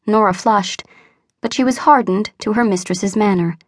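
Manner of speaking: plain reading voice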